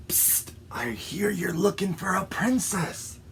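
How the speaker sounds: sly fox whispering; soft, hushed storybook villain; conspiratorial whisper. Acted. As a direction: whisper a secret offer. Fox secretly beckons the frog.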